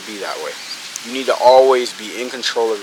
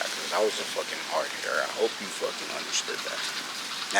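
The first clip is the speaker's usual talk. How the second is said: hushed whispered aside; 3 semitones lower, 13 dB quieter than usual. Real, not acted. Hushed aside after a hard point.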